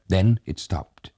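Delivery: ordinary delivery, nothing marked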